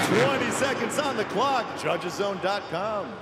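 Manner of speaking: ad read. Acted. Sponsor read again.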